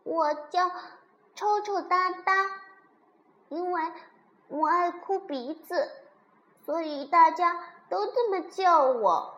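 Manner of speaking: put-on high, loud baby dino voice; little crybaby dinosaur. A narrator's reading. Baby dinosaur introducing himself to uncle, high and eager.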